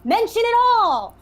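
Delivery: high, loud, slow defiant yell; acted defiant shout. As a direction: dare her to say everything. Acted quarrel, daring the other.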